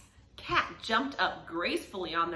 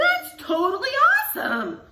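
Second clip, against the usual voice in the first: much higher loud awesome with a laugh; higher delighted boy voice; excited shout; 7 dB louder than usual. Acted. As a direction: shout it with glee. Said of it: "Edison shouted".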